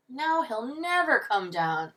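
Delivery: theatrical slow wail; lamenting. A narrator's reading. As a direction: bemoan it with drawn-out woe. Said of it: "Mister Sims lamented".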